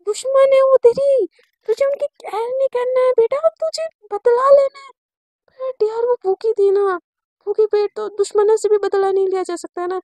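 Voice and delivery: high, loud, pleading with herself; agitated self-scolding. Acted. Sakshi scolds herself over caring for her enemy, agitated. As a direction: voice jumps up in a worked-up argument with yourself.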